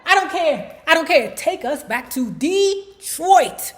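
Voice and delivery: high, loud whiny refusal; a man losing it in the car; mock outburst. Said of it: "Barry like".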